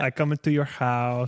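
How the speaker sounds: put-on thick Brazilian-accented English; mock Brazilian accent. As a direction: imitate a Brazilian mom's accent. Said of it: "That was a perfect Brazilian accent".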